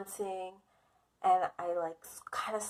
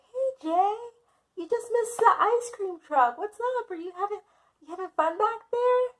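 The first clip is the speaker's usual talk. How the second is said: much higher, cheery carefree parent; mock parent voice; 12 semitones higher, 3 dB louder than usual. Parents telling daughter she missed the ice cream truck, high cheery mock voice. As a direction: sing it out like an oblivious happy parent.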